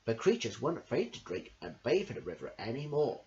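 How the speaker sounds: ordinary delivery, nothing marked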